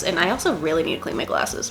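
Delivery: ordinary talk, nothing marked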